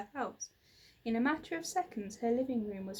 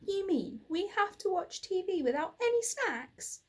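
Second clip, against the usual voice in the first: higher whiny moan; 8 semitones higher than usual.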